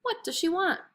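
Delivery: high, light fish voice; a small high fish voice asking; fish's voice. A narrator's reading. Magic fish asks.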